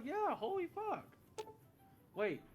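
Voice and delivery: ordinary delivery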